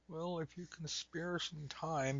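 high, soft and slow mock request; timid mock plea. Preacher mocking a timid prayer to God, soft, slow and meek. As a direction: ask meekly, almost apologising. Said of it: "not like".

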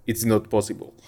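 a deliberately bad South African accent, dry and clipped. Podcaster imitating umpire Murray Erasmus, bad South African accent. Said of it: "such a bad South African accent".

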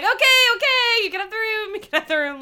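mock frantic surrender. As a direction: give in with a hammed-up near-wail. Acting out Moira giving in.

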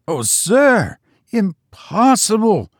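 high horrified cry. A narrator's reading. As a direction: cry out in horror. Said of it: "cried Biggs in horror".

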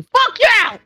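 pitched high and yelled; mock outrage. Real, not acted. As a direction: shout it in mock fury. Playful cursing at friend.

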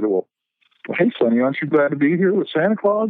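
jolly Santa voice. Retold or imitated. Santa greeting a crying kid.

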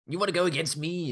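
high, loud cocky challenge; over-the-top anime villain. Retold or imitated. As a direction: play a hammy anime baddie.

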